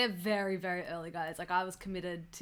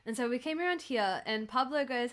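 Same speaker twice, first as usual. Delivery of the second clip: usual speaking voice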